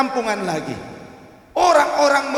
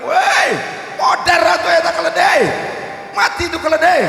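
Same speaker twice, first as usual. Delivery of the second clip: loud, higher jeering shout across the market; villagers shouting; 7 semitones higher, 7 dB louder than usual. Retold or imitated. Villagers at the market yelling at father and son, loud jeering shout. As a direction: yell it out like a crowd in the market. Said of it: "Orang-orang langsung berteriak".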